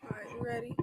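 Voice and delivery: ordinary talk, nothing marked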